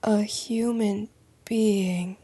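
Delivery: hushed whisper of disbelief. Acted. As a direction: breathe it out in a whisper. Repeating James's words.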